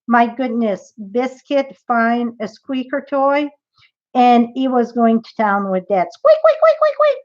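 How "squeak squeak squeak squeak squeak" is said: high, loud squeaky toy squeaks; squeaky toy noise. Retold or imitated. Dog's squeaky toy imitated.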